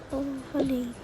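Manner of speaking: usual speaking voice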